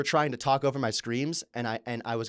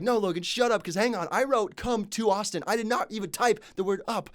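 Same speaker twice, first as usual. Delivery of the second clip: angry denial to a friend correcting his grammar; heated retort; 9 semitones higher than usual. Retold or imitated. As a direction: snap back that you were right all along. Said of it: "I was like".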